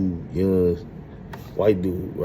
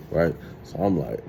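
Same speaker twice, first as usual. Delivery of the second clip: ordinary talk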